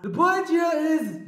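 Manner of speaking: high, loud and slow; slow, high-pitched mock oratory; drawn-out proclamation. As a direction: stretch it out high like a grand announcement. Mock speech to close the video.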